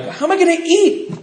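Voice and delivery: high, whiny mock plea. Son pleading with his mom, high whine. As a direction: whine it like a lost kid.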